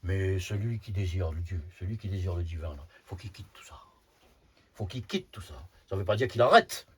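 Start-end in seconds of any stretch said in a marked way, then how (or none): 2.8-3.8 hushed, softer whispered line. Real, not acted. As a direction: drop to a whisper to stress it. Insiste sur l'abandon du monde. (chuchoté, à voix basse)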